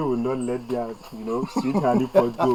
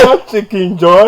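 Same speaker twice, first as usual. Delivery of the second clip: loud, high, slow drawn-out call; hailing a friend; 7 semitones higher, 10 dB louder than usual. Acted. Man teasing his friend by nickname, drawn-out hailing. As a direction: stretch the nickname out loudly.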